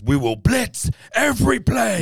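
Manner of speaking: high, slow, rousing coach voice; rally the team like a fired-up coach; coach's speech. Retold or imitated. Quoting the coach's speech to his defense, high and rousing. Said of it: "The best speech of the movie is Will Patton's".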